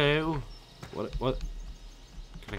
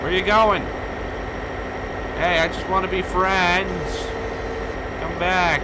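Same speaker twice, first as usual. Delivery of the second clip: high, loud pleading call; loud plea across the snow; 9 semitones higher, 5 dB louder than usual. Real, not acted. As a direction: shout after him to come back. Calling a fleeing skier back.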